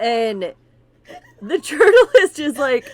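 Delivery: words squeezed out through loud laughter; can barely talk for laughing; laughing hard. Real, not acted. Telling the tooth story while cracking up.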